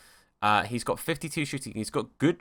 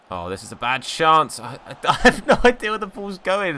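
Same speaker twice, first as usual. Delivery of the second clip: laughing; 5 semitones higher, 4 dB louder than usual. Real, not acted. Can't see ball, laughing.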